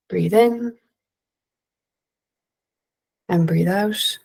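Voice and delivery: slow, calm breathing cues with long pauses; guided breathing. Acted. Presenter leading the audience in five calm breaths.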